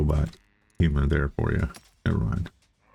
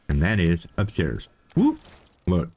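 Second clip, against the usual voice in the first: usual speaking voice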